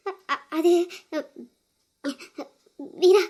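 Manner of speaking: stammering through sobs; halting, breath catching between words; crying. Acted. Crying sister struggling to explain to Veera, tearful. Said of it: "அழுதுகிட்டு நிக்கிறீங்க".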